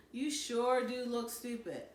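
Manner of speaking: usual speaking voice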